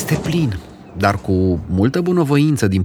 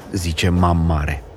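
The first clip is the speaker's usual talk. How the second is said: ordinary delivery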